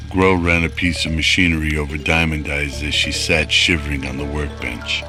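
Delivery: normal talking voice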